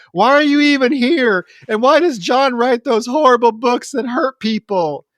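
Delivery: high, loud mock scream at Julie; retold screaming fit. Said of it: "starts screaming at Julie".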